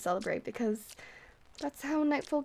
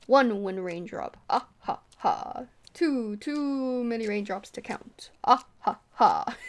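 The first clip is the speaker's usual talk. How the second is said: vampire counting; 6 dB louder, slower than usual. Pretend.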